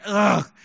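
drawn-out groan of revulsion; disgusted ugh. Acted. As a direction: groan as if sickened. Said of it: "It makes me sick".

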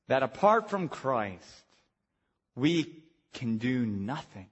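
hushed, low, with weighty pauses; solemn preaching. Real, not acted. Preacher on helpless sinners apart from Christ, solemn.

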